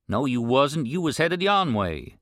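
gruff rancher. Acted. Rancher questions the boy on the road, irritated. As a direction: demand an answer, irritated.

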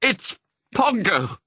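high, drawn-out exclamation; slow, high cry of amazement; amazed cry. A narrator's reading. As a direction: cry out slowly in astonished recognition. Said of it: "cried Mister Dearly".

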